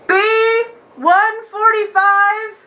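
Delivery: slow, drawn-out call of a queue number; number caller. Retold or imitated. Narrator imitating the clerk calling the next number, slow and drawn out. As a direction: call it out slowly like the DMV clerk.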